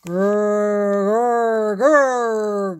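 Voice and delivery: loud playful growl; monster growl. Acted. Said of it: "growled Alfred".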